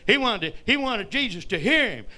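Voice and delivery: normal talking voice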